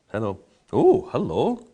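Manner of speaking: play-acting finding a secret in the glass; discovering surprise. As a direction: greet the hidden flavour as you suddenly find it. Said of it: "only by going".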